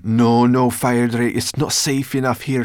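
high, loud protest; urgent cry. A narrator's reading. Sorrel hauling the tired dragon up, urgent cry. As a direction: cry out to stop him. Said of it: "she cried".

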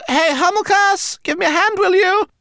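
high-pitched bossy rodent; rat calling out. A narrator's reading. Rat wanting help from her dazed companion, calling briskly. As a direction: call over briskly in a high voice.

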